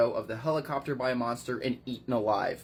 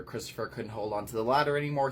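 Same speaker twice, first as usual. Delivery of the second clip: ordinary talk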